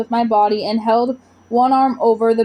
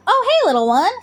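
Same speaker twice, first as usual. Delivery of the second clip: high, tender coo; 8 semitones higher, faster than usual. Acted. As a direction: coo it like to a baby. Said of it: "Gorp cooed".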